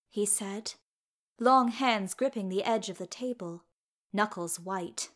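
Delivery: ordinary delivery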